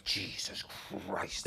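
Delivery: voiceless, awed breath of dread; hushed terror. Retold or imitated. Co-driver retelling the start line to host, hushed terror. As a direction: whisper it like stunned horror.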